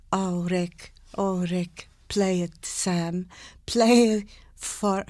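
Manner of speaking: slow, soft Bergman, crisp consonants; lightly voiced Swedish film star; Ingrid Bergman voice. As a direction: deliver it slowly like Bergman, ends of words clear. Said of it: "like they're reading".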